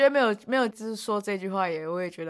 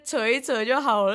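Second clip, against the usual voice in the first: usual speaking voice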